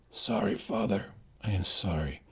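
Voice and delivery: fading, ashamed apology; faint apology. Acted. Inigo begging his father's forgiveness, weak and faint.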